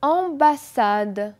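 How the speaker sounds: ambassade drawn out slowly; teacher models ambassade; demo word. Shown as a demonstration. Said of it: "Répétez après moi".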